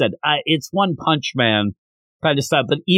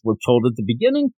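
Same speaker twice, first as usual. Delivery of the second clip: normal talking voice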